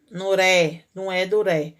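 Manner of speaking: ordinary talk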